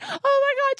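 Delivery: very high, squeaky horrified tween voice; panicked daughter. Retold or imitated. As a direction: squeal like a mortified kid. Starts panicking and writhing.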